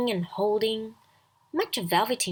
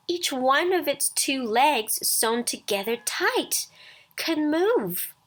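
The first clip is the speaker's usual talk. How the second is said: ordinary delivery, nothing marked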